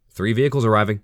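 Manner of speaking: eager, quick report; spotting something, thrilled; excited. A narrator's reading. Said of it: "with excitement in his voice".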